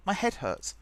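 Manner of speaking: high, loud cry for help with a laugh; playful overwhelmed plea; mock exasperation. Real, not acted. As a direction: throw up your hands, head spinning. Confused by Marvel timeline.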